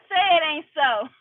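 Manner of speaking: ordinary delivery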